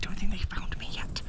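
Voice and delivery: unvoiced hushed whisper while hiding. Real, not acted. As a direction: whisper so the seekers can't hear. Hiding during hide and seek.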